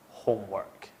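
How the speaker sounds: ordinary talk, nothing marked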